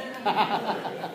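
quick amused laugh at own joke; a burst of laughter after the punchline; short laugh. Real, not acted. Laughing at his own crude line to the meeting.

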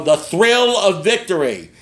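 slow, drawn-out triumphant announcer; TV sports announcer. Retold or imitated. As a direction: stretch it out high like a sportscast. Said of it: "And he would go".

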